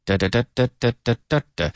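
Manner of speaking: DA, DA in a quick even string of beats; sentence rhythm. Shown as a demonstration. Teacher showing the rhythm of the language, beaten syllables. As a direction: tap out the sentence as "da, da, da". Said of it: "It's the rhythm".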